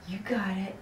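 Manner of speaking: soft unvoiced whisper; whispered encouragement. Real, not acted. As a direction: whisper it to calm the dog.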